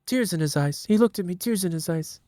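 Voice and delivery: high, soft Trump voice telling a tearful tale; Trump impression. Trump speech bit told to his Republican family, high mock voice. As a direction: do Trump retelling a sentimental story. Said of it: "where Trump does a speech".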